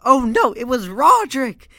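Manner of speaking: high, loud hammed-up outburst, easing off at the end; excited yelling. Fan retelling Roderick's prank on his brother, excited yelling. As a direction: yell it like revealing a huge prank. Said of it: "'cause I was yelling".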